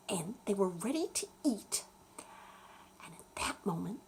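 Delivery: ordinary delivery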